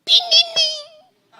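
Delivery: very high, slow, laughing tinini; bell ringing. Retold or imitated. Preacher imitates the bell cutting off a bad preacher, high and slow. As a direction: ring it long and mocking.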